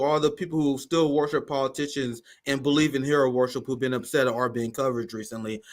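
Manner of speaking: ordinary delivery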